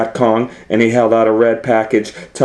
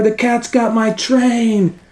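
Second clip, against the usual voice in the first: panicked engineer; 12 semitones higher than usual. Acted.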